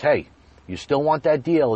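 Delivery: imitated manager's call, high and loud; calling back. Manager turning around to a departing customer, calling back. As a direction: toss the offer back with a sudden loud lift.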